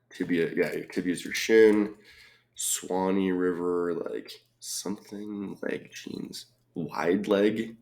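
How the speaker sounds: normal talking voice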